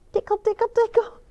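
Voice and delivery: high singsong tickle tickle; high giggly tickle chant; playful tickle. Acted. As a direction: tease like tickling a baby. Feet tickling.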